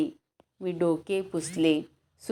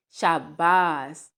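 praise; 3 dB louder, slower than usual. Acted. Balu praises the jump.

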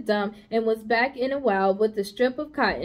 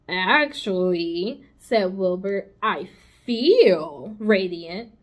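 slow, proud pig; 3 semitones lower, 3 dB louder, slower than usual. Acted. Wilbur answers proudly.

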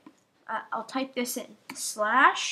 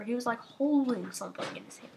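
normal talking voice

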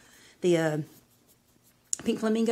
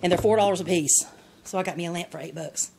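normal talking voice

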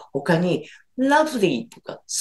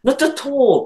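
British "Not at all" as "noto to", crisp clipped t's; British accent; 9 semitones higher, 4 dB louder, much slower than usual. Shown as a demonstration. Teacher demonstrating British English to Japanese learners, British accent. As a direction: say "Not at all" like a Brit at the office. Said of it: "イギリス発音を聞いたとき".

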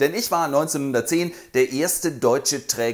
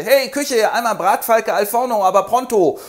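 high, loud order to kitchen; calling out; 8 semitones higher, 5 dB louder than usual. Acted.

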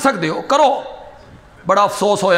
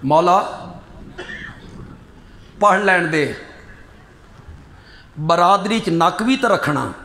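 low, lightly voiced begging mourner; pleading relative; 5 semitones lower, 4 dB quieter than usual. Relative begging the cleric to read the funeral prayer, lightly voiced. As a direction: mildly act out a plea to the cleric.

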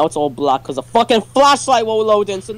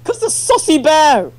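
excited shout; 11 semitones higher than usual. Real, not acted. Recalling bear, shouting high.

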